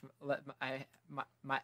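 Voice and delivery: tripping over the words, restarting; stuttering. Real, not acted. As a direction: stumble and repeat the first syllables. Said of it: "damn, I'm stuttering".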